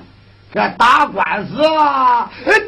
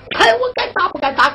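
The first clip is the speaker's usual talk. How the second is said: rising angry threat; 8 semitones higher, faster than usual. Acted. Threat repeated, rising each time.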